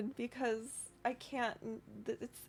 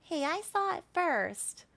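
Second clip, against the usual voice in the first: higher, petulant little fungus; twinkling breathy fairy whisper; 7 semitones higher than usual. Acted. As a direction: squeak it like a jealous sprout. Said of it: "you hear another little".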